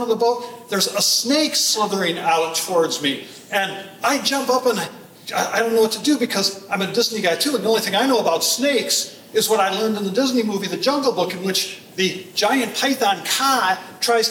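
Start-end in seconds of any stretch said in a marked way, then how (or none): none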